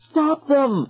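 put-on high-pitched urgent girl's cry; girl chiming in. A narrator's reading. Another girl joining the chase, urgent high call. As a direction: chime in with a high, urgent command. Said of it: "another girl chimed in".

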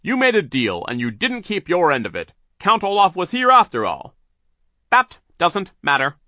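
Mister Poe scolding Sir over the broken deal; stern argument. Acted. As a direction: press the point firmly.

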